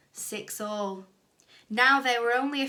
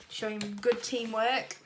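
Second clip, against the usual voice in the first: usual speaking voice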